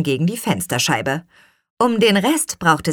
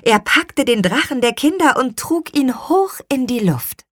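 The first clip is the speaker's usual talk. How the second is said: usual speaking voice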